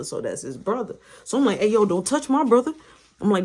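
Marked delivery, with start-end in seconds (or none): 1.6-2.7 loud, quick warning; retold fighting talk; confrontational. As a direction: act out squaring up for a brother. Acted quarrel between brothers.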